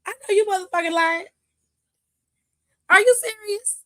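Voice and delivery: rising to a high, loud disbelieving cry; hammed-up incredulous Roman; imitating Roman. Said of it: "Roman was like".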